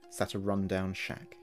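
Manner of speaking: ordinary delivery, nothing marked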